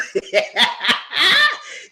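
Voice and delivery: loud, high burst of laughter; high cackling laugh. Real, not acted. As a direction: crack up loudly. Laughs at her own remark.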